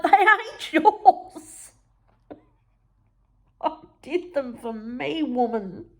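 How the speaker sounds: high, slow, laughing indignation; laughing protest. Retold or imitated. To mum, high laughing protest. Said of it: "And I'm like".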